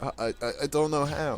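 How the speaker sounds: imitated stammer of a frozen child. Sister freezing when told to call for help, mock stammer. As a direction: stumble on the first word, then blurt it softly.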